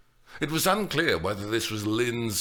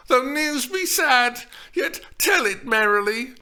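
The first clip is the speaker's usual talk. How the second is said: heedless urging; 6 dB louder than usual. A narrator's reading. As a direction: urge her on, careless of her pain. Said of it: "Steph urged her heedlessly".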